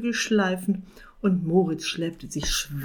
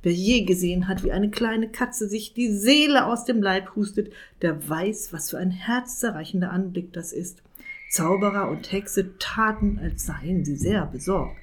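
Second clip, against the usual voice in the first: ordinary delivery, nothing marked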